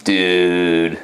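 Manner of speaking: drawn-out "dude"; imitated catchphrase. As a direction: stretch out "dude" as the brother's imitated greeting. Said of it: "like that".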